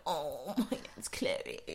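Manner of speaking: mock impression. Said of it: "Do you mean you're like".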